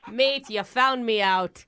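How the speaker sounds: hammed-up Australian confession; fake Australian accent. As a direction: put on a matey Australian drawl. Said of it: "totally different accent".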